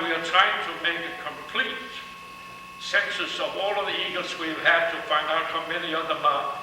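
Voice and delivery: normal talking voice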